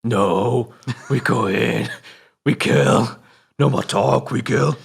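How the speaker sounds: loud, drawn-out war cry; film villain. Acted. Asked for a film rundown, answers as a loud film villain. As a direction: chant it like a menacing raider. Acts out the film's villains on request.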